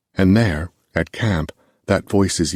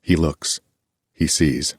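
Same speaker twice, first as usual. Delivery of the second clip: ordinary delivery, nothing marked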